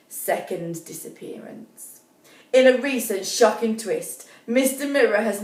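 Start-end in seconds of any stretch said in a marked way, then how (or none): 0.1-2.0 whispered, very soft; whispered news headline; hushed headline. Acted. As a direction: breathe the headline like a secret. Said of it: "headline said in a hush".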